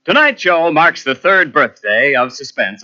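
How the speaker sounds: booming radio announcer over music; old-time radio show opener; announcer intro. Acted.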